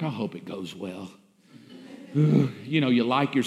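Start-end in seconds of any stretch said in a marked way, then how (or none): 0.0-1.1 very low, soft, drained murmur; weary hope. Retold or imitated. Pastor doubting his own sermon, low weary murmur. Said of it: "Sometimes like". 2.1-2.6 weary sigh. Retold or imitated. Weary pastor after sermon.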